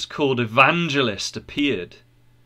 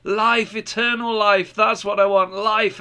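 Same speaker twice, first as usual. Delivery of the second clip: high-pitched, mildly shouted cries; shouting; 9 semitones higher than usual. A narrator's reading. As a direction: cry out eagerly as you run off, pitch raised.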